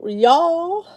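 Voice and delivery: high, loud, rising Southern "y'all"; mock Southern drawl. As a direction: swing it upward in playful Southern. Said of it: "I say it now just to be funny".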